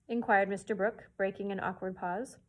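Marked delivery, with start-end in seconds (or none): none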